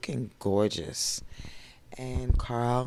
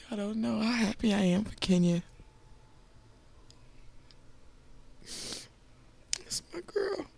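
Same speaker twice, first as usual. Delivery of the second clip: crying, catching breath midword; 11 semitones higher, 3 dB quieter than usual. Real, not acted. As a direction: voice breaking, high and tearful. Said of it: "I'm sorry, I'm crying".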